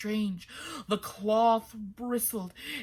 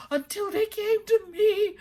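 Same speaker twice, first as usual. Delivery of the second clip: higher, louder breathless rush; excited rush; 13 semitones higher, 8 dB louder than usual. Acted. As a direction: blurt it out, overexcited. Fisherman in tears, excited.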